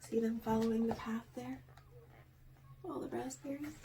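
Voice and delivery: soft, fast pointing out; quiet aside. Real, not acted. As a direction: show the picture softly. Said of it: "aside to child listener".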